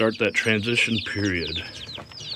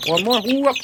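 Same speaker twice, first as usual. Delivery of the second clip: coaxing a wild bird; 12 semitones higher, 6 dB louder than usual. Real, not acted. Catching chicks, voice jumps up.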